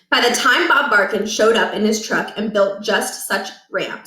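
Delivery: ordinary delivery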